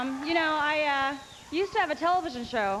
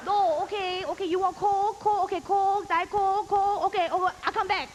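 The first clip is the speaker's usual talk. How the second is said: put-on much higher, clipped Chinese-accented waitress; louder Chinese accent waitress refusing substitutions; 7 semitones higher, 4 dB louder than usual. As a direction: rattle off the orders as a brusque Chinese waitress. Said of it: "the waitress comes over".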